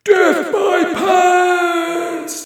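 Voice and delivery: shrieking, very high, drawn-out chant; talking underpants. A narrator's reading. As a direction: join the war cry in a squealing creature voice. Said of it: "joined in Glenn and Olaf, the talking underpants".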